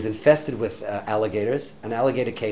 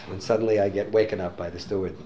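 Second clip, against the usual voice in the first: ordinary delivery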